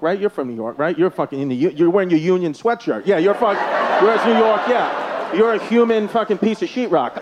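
normal talking voice

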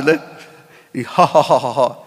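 normal talking voice

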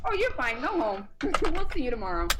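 high breezy brush-off, laughing at the end; a mock cheery doctor sending a sick patient home; dismissive doctor. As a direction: mimic a doctor waving the patient away. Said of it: "the doctor would be like".

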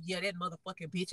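imitating him trash-talking quietly; muttered insult behind back. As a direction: sneer it under the breath. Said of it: "You would walk off".

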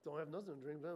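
hushed, low muttered complaints; low grumbling. Acted. Preacher acts Israelites missing food and drink, low grumbling. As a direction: grumble to yourself, barely aloud. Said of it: "a low little grumbling process going on".

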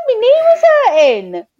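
mock whiny complaint. Complaining to her trainer about her knee, high laughing whine. Retelling protest at hard session.

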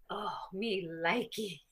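soft, slow, playful "me likey"; cutesy delight. Retold or imitated. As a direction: coo it like a delighted kid. Gleeful reaction to tough drills.

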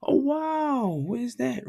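high, chirpy imitation of a polite chat; lightly voiced small talk. Host imitating strangers chatting about Mozambique, mock chirpy. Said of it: "then I get straight to the comments".

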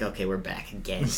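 let-down fan muttering; resigned sigh. Retold or imitated. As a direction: drop the energy, quietly defeated. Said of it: "and I was like".

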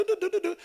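soft, high little doo-doo beeps; sing-song app noises, very quietly; imitated phone taps. Shopper opening the phone app, soft imitated beeps. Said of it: "I opened my phone app".

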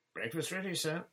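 high, bright cheerful call to the captain. A narrator's reading. The mate announces breakfast to the captain, cheerful high call. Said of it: "called the mate cheerfully".

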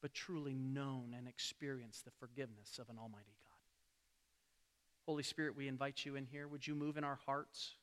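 low, very soft praying voice; gentle, low-pitched prayer to God; hushed prayer. Real, not acted. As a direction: pray quietly and reverently. Closing prayer before the sermon.

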